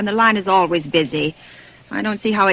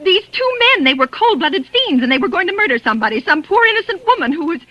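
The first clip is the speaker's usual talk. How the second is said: stammering, rushed, high in panic; frantic plea; 5 semitones higher than usual. Acted. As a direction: pour it out desperate to stop a murder.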